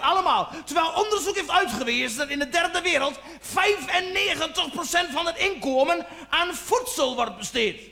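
high-pitched, unstoppable mock rant; ranting tirade. Janmaat railing on about asylum seekers, ranting. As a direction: build a hammed-up tirade, pitch climbing. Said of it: "dat ging zo maar door".

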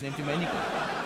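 normal talking voice

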